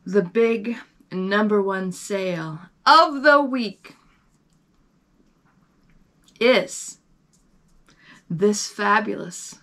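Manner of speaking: slow, haughty luxury announcer; snobby voice. Pretend. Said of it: "should I get my snobby voice on?".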